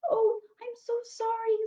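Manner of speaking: put-on high apologetic young girl. A narrator's reading. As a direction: say sorry sweetly, high. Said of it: "said Rapunzel".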